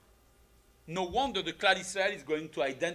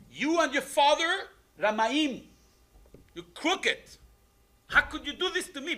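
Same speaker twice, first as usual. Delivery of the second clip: higher and louder, hurt and indignant; betrayed accusation; 9 semitones higher, 6 dB louder than usual. Acted. Yaakov to Leah, accusing her of deceit: indignant outcry.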